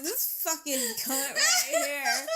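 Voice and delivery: high, slow words broken by laughter; laughing inner insult. Retold or imitated. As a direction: spit it out while cracking up. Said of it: "I'm like".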